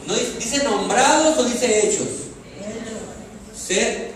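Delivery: loud, emphatic sermon delivery; full-force preaching. Real, not acted. Preacher driving a verse home to the congregation, full-force preaching. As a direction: preach it out, stretching the key words.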